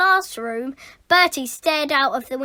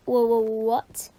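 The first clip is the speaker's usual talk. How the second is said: stammered shocked gulp; 4 semitones lower than usual. Acted. Boy realising his mistake, stammered gulp. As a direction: stutter in dismay. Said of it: "gulped Bertie".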